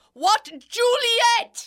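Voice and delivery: a long, high call across the house. Acted. Nurse calling Juliet from another room, high drawn-out call. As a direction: draw out the name to call her in.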